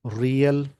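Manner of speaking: रियल said slowly and clearly as two syllables, ri-al; teacher modelling रियल as ri-al; pronunciation demo. Shown as a demonstration. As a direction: model the word रियल carefully for students. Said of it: "इसको बोलेंगे".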